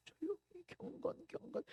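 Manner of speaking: very soft, hushed piety; quiet piety. Shown as a demonstration. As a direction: barely voice it, demurely. Mocking prayer that is only quiet. (아주 작고 조용하게)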